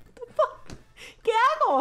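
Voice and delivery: high, loud what the fuck; blurting out in disbelief; shocked outburst. Retold or imitated. Narrator replays her own panic at the roommate's blood. Seeing roommate cut herself.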